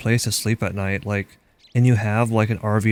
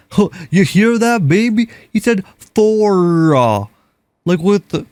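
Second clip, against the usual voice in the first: put-on Texan accent; 9 semitones higher, 6 dB louder than usual. Texan stranger telling his girlfriend on the phone about the hard R, in a loud high mock Texan accent. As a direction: crow it to the girlfriend in a thick Texas twang. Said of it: "the accent on that guy was, like, insane".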